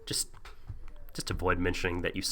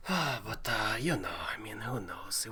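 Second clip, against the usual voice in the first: whispering; 9 dB quieter than usual. Pretend. Whispered aside in Viking bit.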